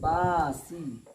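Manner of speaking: बासी said slowly with the सी clearly sounded; slow correct reading. Shown as a demonstration. Teacher shows the correct reading of बासी, slowly. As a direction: stretch बासी, sounding सी fully. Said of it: "कैसे पढ़ोगे?".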